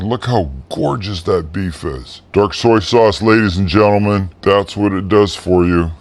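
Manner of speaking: normal talking voice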